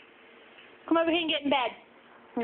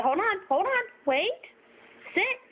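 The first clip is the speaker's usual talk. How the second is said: talking to a dog; 10 semitones higher than usual. Real, not acted. Holding dog back.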